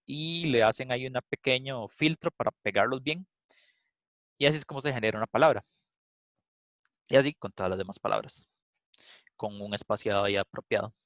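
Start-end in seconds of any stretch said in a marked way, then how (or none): none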